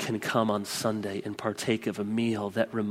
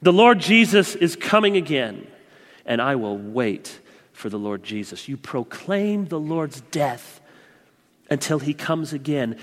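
usual speaking voice

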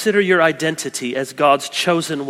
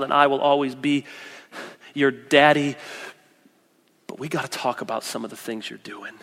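tender father; 4 semitones lower, 5 dB quieter than usual. Retold or imitated. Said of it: "or you can say".

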